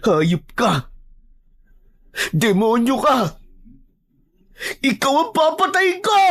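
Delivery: high-pitched scream of rage; furious shout. Acted. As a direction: hurl curses and threats at the killer. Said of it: "Galit na galit akong sumigaw". (galit na galit na sigaw)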